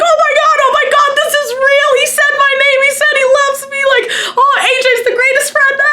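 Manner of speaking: very high-pitched, hammed-up tearful squeal; happy sobbing. Fan reliving her reaction to a video shout-out, happy sobbing. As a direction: imitate overjoyed sobbing in a high, squeaky wail. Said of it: "I'm just sobbing. I'm so happy".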